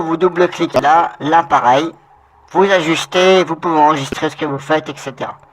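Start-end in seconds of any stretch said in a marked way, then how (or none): none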